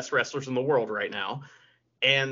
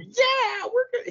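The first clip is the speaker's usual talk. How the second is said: much higher, goofy mock promoter voice; Tony Khan impression. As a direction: squeak it out like a giddy promoter. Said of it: "his little dokey speech".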